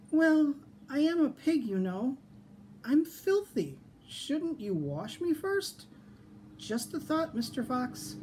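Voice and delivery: high-pitched, polite little piglet. A narrator's reading. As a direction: sweetly suggest it in a high small-pig voice. Piglet stalling the fox in story.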